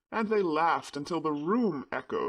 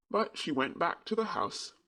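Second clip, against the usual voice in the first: usual speaking voice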